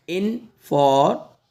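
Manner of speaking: Information slowly split "in - for - meshan"; syllable by syllable. Shown as a demonstration. Teacher building up information for learners, slowly in parts. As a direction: break information into slow, separate parts. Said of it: "पहले आप इसको पढ़ो in".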